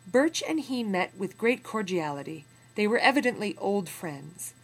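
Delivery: put-on steady, measured reading of an old memoir; story narration. A narrator's reading. Teacher reading a memoir passage to students, in measured narration. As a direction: read the passage to the class with weight.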